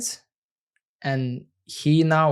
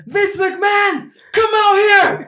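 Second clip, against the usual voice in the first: wrestling promo shout; 7 dB louder than usual. Pretend.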